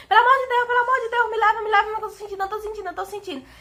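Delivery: frantic high-pitched imitated plea, starting loud; retold pained pleading. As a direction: act out begging for help in pain. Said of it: "senti uma dor tão forte que eu falei". (súplica aflita imitada, voz aguda)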